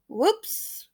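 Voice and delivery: soft, lifted mishap exclamation; small oops. A narrator's reading. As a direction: let out a soft little oops after a tumble. Child falls off chair mid-song.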